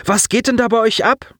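put-on high-pitched, incredulous boy; shocked outburst at a high pitch; exclaiming. A narrator's reading. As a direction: demand an explanation, voice way up. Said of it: "rief Colin aus".

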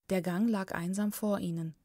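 plain reading voice

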